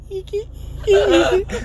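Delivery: shrill hiccuping cackle; high squealing laugh. Real, not acted. Wild laughing fit.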